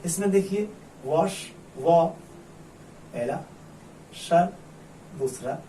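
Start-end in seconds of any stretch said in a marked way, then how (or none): 1.8-2.1 वॉ cut off alone and louder; single syllable. Shown as a demonstration. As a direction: sound out वॉ as the first syllable. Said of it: "कितने syllable हैं". (वॉ को अलग syllable बनाकर ज़ोर से)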